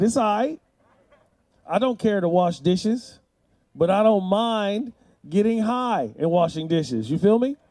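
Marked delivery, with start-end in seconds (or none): none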